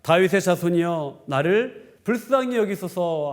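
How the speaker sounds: ordinary talk, nothing marked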